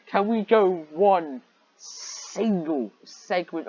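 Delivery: loud, high, slowed-down plea; exasperated rant. Real, not acted. Reader pleads loudly with the story. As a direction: drag it out in disbelief. Ranting at the fan service.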